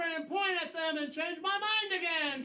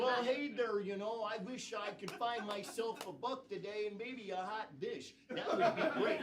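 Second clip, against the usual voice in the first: a lightly voiced Wisconsin accent drifting into New York, lower and softer; attempted Wisconsin accent; 8 semitones lower, 7 dB quieter than usual. Improviser answering in character, in a shaky Wisconsin accent. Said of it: "You were trying to do a Wisconsin accent, but it cli-, quickly became New York".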